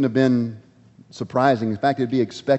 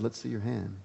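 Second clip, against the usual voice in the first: much softer, lower, gentle invitation; gentle hush; 3 semitones lower, 15 dB quieter than usual. Acted. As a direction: say it softly, like calming someone. Jesus gently speaks to man.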